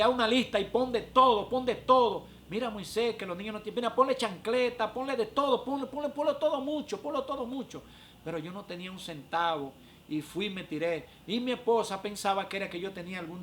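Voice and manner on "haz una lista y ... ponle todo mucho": high, loud, piling up orders; raised-pitch retold nagging, pushing for more and more; imitating insistent wife. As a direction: act out a wife rattling off her demands. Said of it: "me salió con decirle".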